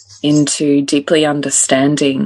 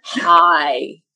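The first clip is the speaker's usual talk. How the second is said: put-on toddler hi; 3 semitones higher than usual. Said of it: "Like this, she says into the".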